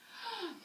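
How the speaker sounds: sharp mock gasp of horror; shocked gasp. Officer reacts with shock.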